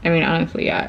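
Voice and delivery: usual speaking voice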